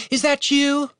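high calling voice; woman calls to her brother, high; called out. Acted. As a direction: call his name across the dark. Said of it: "she called out".

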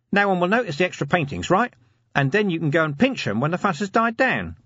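high-pitched, eager scheming corporal; eager schemer Nobby. Acted. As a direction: pitch a crafty plan, voice well up. Nobby explains his scheme.

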